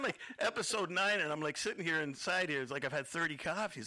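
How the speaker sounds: ordinary talk, nothing marked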